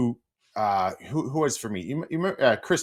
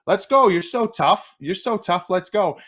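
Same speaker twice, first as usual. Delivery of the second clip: much higher, louder sing-song taunt; mocking dare; 10 semitones higher, 4 dB louder than usual. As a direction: tease him into fighting. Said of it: "he's like".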